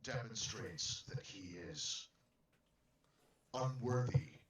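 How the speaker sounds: put-on grave, measured old believer; solemn in-character voice. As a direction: speak as a devout elder. In-character speech in the game.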